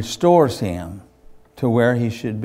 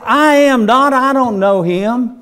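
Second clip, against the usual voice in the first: panicked denial; 11 semitones higher, 7 dB louder than usual. Acted.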